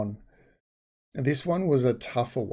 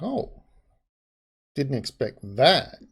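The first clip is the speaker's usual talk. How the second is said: mock surprise. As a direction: act out being caught off guard. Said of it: "that is like".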